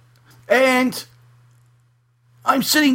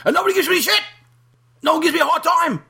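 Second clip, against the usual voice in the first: boastful persona; 13 semitones higher, faster than usual. Acted.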